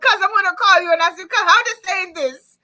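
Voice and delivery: high, loud, fast and amused; excited laughing rush. Real, not acted. Host joking she'll phone her cousin, excited. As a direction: rattle it off, high and gleeful.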